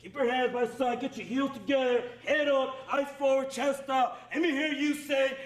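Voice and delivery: usual speaking voice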